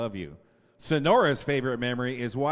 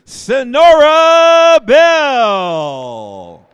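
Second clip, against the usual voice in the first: drawn-out ringing name call, much louder and higher; announcer call; 10 semitones higher, 23 dB louder, much slower than usual. Real, not acted. Announcer calls the next senior to the crowd, long ringing shout. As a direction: stretch the name out for the gym.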